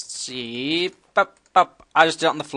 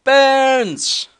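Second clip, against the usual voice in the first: gleeful cry; 12 semitones higher, 9 dB louder than usual. Real, not acted. Gleeful at loot.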